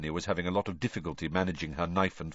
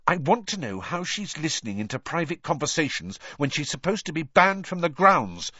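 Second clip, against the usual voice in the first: angry; 8 semitones higher than usual. A narrator's reading. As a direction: complain angrily. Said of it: "said Hermione angrily".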